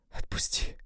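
whispered plea; urgent whisper to let go; breathless whisper. A narrator's reading. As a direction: hiss it out, barely voiced. Dina pleading in a struggle.

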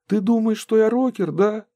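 put-on high, earnest boy's voice; young boy voice. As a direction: play him as a plucky kid. Boy hero voiced in story.

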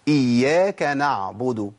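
إياك نعبد slow, with the ع of نعبد sounded; تلاوة صحيحة; correct recitation. Shown as a demonstration. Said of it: "سمعت صوت العين مني؟".

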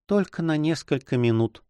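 put-on high, wistful young woman's voice; wistful, lightly voiced heroine; sad whisper. A narrator's reading. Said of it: "прошептала она печально".

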